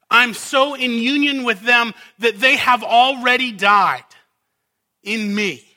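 fervent pulpit preaching; full-force preaching. Acted. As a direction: preach it hard, building to the climax.

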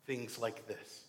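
ordinary talk, nothing marked

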